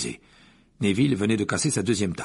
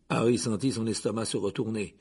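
usual speaking voice

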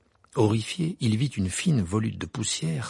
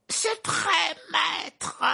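very high, strained squealing servant; cringing high cry to his master; shrill servant; much slower than usual. Acted.

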